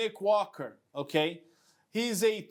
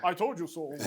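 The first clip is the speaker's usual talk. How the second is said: imitating Bob; 4 semitones lower, faster than usual. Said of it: "do a Bob impersonation".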